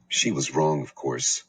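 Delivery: ordinary delivery, nothing marked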